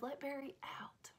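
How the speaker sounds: breathy whisper, no voice at all. Retold or imitated. Babysitter repeating the dad's real instruction, whispered. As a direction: whisper the words like the dad said them. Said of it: "He said".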